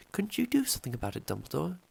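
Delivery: high, fast, worried plea; anxious McGonagall. A narrator's reading. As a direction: rush it out, fretting. McGonagall pleading with Dumbledore.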